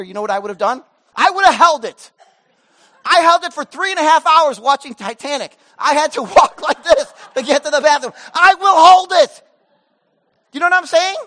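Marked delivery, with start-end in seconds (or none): 1.1-11.2 high, loud, laughing bravado; excited comic boast. Real, not acted. Pastor joking to the church about his wife's test, loud and excited. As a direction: crow about it like a proud tough guy.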